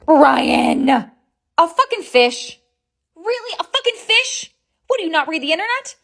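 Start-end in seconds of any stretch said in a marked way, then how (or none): none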